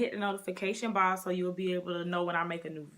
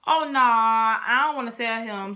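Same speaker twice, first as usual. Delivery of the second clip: louder, reluctant balking; mock-scared refusal; retold scared reluctance; 3 semitones higher, 8 dB louder than usual. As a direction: act out dreading telling dad. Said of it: "I was so scared".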